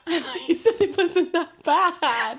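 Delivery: high, slow, through helpless laughter; giggling tearful tease; laughing hard. Real, not acted. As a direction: squeeze the words out while laughing. Teasing while both laugh-cry.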